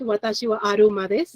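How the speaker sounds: "aruma" said the Japanese way, with an r in place of the l; Japanese accent. Shown as a demonstration. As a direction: Japanese pronunciation of "Watashi wa aruma desu" with r for l. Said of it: "or with the Japanese accent".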